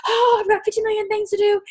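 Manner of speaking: high, fast frantic babble; frazzled overwhelmed self. Retold or imitated. Overwhelmed person zigzagging between tasks, high frantic babble. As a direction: rattle it off like you're lost mid-room. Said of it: "and you're like".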